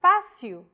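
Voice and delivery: "Fácil" with open stressed "á", FAH-seel; stressed first syllable. Shown as a demonstration. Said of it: "used to indicate a stressed vowel".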